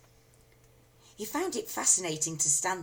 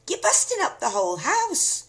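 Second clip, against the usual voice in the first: put-on alarmed boy; 11 semitones higher, 8 dB louder than usual. A narrator's reading. George pleading.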